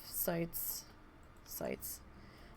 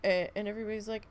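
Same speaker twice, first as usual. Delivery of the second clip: ordinary delivery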